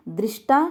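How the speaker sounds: दृष्टा as "drish-taa"; mispronunciation demo. Shown as a demonstration. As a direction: say दृष्टा with an i after "dr". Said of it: "शुद्ध शब्द नहीं है".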